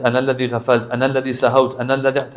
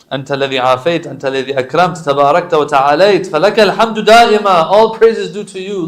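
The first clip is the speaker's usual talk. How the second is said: fast litany building louder and higher; Arabic supplication recital; 4 semitones higher, 10 dB louder, much faster than usual. Acted. Praising God before the congregation, building devotional fervour. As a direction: drive the praise up to a loud peak.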